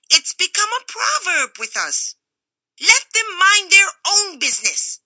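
very high, loud indignant squeak; squeaky Attilio. A narrator's reading. Cameo cutter rants at Naples, high and loud. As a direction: rant shrilly against Naples. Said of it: "the little squeak that went with it".

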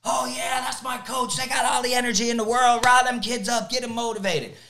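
high, hyped-up shout of praise; enthusiastic cheering. Acted. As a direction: mimic a fired-up fan raving about the coach. Acting out fans cheering an energetic coach.

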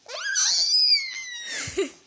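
very high, loud squeal. Real, not acted. Baby squealing during tummy time, high squeal. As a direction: let out a long, piercing squeal. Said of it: "squealing like a little girl".

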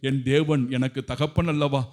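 normal talking voice

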